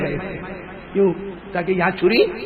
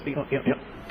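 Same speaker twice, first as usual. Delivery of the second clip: whispered demonstration; 5 semitones lower, 13 dB quieter than usual. Acted. Trembling hand shown.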